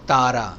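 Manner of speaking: تارا with a dry T, no puff; Urdu-style hard T in تارا; unaspirated Urdu T. Shown as a demonstration. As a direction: hit the T of تارا with no h after it. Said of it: "تارا نہیں ہے".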